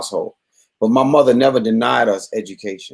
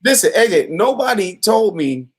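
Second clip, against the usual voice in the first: ordinary talk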